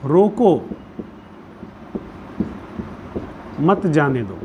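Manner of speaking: "रोको" alone, long pause, then "मत जाने दो"; pause after रोको. Shown as a demonstration. Teacher dictating an example sentence, long pause after "रोको". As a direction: break after "रोको", keep "मत जाने दो" together. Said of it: "comma का प्रयोग बदल दीजिए".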